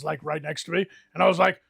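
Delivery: usual speaking voice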